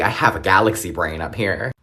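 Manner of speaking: ordinary delivery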